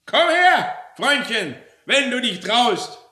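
very high, loud battle cry; fierce, defiant yell; challenging shout. Acted. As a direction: call out a fighter to brawl. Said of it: "spuckte sie in die Hände und rief".

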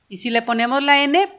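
ordinary delivery

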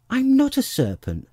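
high, loud protest; offended little-girl outburst; indignant. A narrator's reading. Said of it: "said Alice indignantly".

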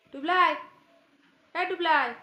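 high, loud name call; loud pet call; calling the cat. Real, not acted. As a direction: shout the cat's name across the room.

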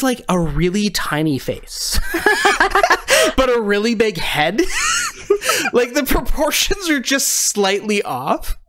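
real laughter breaking through the description; giggling while describing the face; laughing through the words. Real, not acted.

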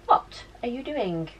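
cooing at the dog. Real, not acted. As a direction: coo it softly to the dog.

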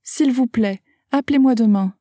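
normal talking voice

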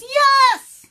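high, very loud exasperated-crowd shout; shouted chorus answer. Acted. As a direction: yell it as a whole crowd would. All animals shout in answer.

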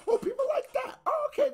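high, laughing "oh, okay" of sudden discovery; mock surprised realization. Reactor mocking his own discovery of what viewers like, high surprised laugh. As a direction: play the dawning surprise, half laughing.

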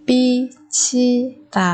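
very slow, separated "bichida"; syllable by syllable. Shown as a demonstration. Teacher modelling a word for learners, very slowly. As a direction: pause after each syllable of "bichida".

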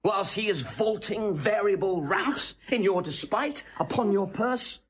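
impassioned tirade. Acted. Seducer whips up the princess against her husband, soaring and heated. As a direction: work her up, climbing high with outrage.

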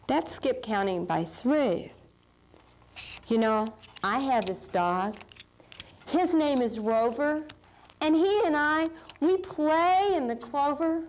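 ordinary talk, nothing marked

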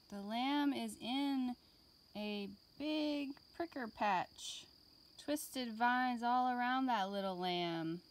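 put-on lively read-aloud for young children; gentle classroom story voice; storybook reading. A narrator's reading. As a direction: read it like a picture book to kids. Reading picture book to class.

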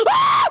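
sudden very high shriek of surprise; startled scream. Real, not acted. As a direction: shriek at what pops up. Reacting to game on stream.

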